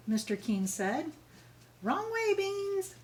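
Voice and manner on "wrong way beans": high called-out correction; calling the dog. Acted. Principal calling after Tilly's dog, high called-out warning. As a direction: call across to a wandering dog.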